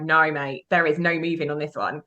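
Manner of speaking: low, quiet finality; no-nonsense voice. Retold or imitated. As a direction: imitate an immovable command. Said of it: "'Cause that voice was like".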